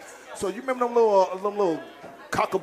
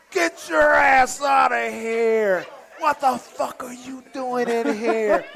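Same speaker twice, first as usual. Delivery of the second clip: higher, slow mock yell at the players; imitating an angry coach; 4 semitones higher, much slower than usual. Coach catching players in the closet, mock furious yell. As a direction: bellow it out like the furious head coach. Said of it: "he said".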